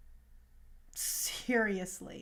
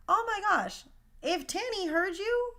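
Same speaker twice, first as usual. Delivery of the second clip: excited gasp; 8 semitones higher than usual. Retold or imitated. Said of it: "And I'm like".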